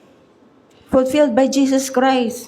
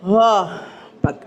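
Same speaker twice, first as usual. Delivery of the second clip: ordinary talk, nothing marked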